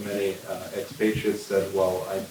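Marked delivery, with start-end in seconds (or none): none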